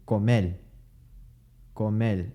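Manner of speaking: comel with an l at the end instead of r; Puerto Rican l for r. Shown as a demonstration. Teacher imitating Puerto Rican comel. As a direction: swap the final r for l. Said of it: "They'll say".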